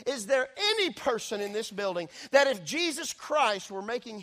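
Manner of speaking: loud, high, slowing on the place names; full-force preaching. Acted. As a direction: call out each town like a challenge. Preacher challenging the congregation.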